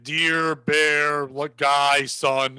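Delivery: low, drawn-out single words; name each thing slowly in a deep primitive voice; caveman naming drawings. Acted. Early man naming his wall drawings to another, low and ponderous caveman voice.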